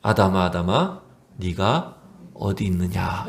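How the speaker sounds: low, soft, unhurried question; gentle calling. Acted. God seeking Adam after his sin, gentle and unreproachful. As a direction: call tenderly, drawing out the question. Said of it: "이렇게 물으셨어요".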